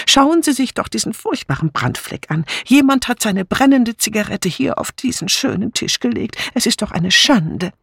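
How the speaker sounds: high, fussy tut-tutting; disapproving. A narrator's reading. Dora Banner complaining to the guests, high and disapproving. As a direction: scold like a fussy old maid. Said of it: "bemerkte Dora Banner missbilligend".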